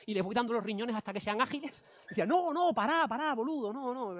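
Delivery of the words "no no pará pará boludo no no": higher, urgent Argentine imitation; imitated Argentine protest. Colleague stopping the speaker in alarm, imitated Argentine accent. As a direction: plead to stop in an Argentine accent. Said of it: "es argentino".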